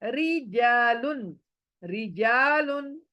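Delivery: रिजालुन slow and stretched, light thin री. Shown as a demonstration. Teacher repeats रिजालुन slowly with a thin R. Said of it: "बारीक कर रही हूँ".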